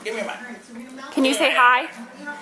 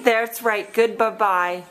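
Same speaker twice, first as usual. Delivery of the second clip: ordinary talk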